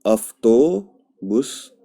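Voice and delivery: अफ्तोबुस drawn out slowly with an f for the v; slow model word. Shown as a demonstration. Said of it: "ये sound कैसा करेगा?".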